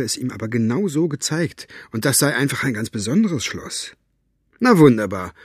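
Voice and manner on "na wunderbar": lightly mocking exclamation, louder; a touch of scorn; mock it with a loud opening. A narrator's reading. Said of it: "spottete Elinor".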